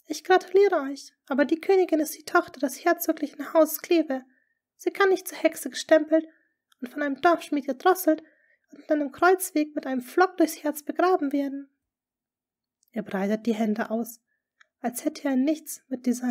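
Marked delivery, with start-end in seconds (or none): 0.1-11.7 high, loud, urgent lady's voice; anxious court lady. A narrator's reading. Lady Rochford warning the duke, high and urgent.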